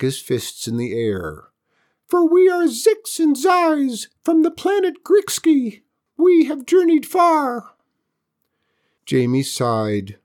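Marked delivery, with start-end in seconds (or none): 2.1-7.7 very high, loud squeaky alien; tiny alien voice. Acted.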